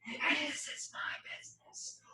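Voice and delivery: breathy whisper, no voice. Shown as a demonstration. Coach showing how to whisper the phrase. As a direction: whisper it softly. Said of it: "you could whisper".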